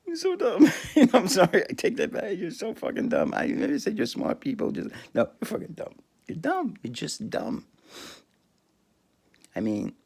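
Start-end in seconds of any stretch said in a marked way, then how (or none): 0.0-7.7 mocking. Real, not acted. Mocking believers, laughing.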